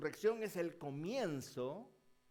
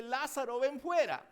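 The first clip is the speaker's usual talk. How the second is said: loud, higher call of the name, then a strong command; 8 semitones higher, 11 dB louder than usual. Retold or imitated. Jesus calling Lazarus out of the tomb, loud command.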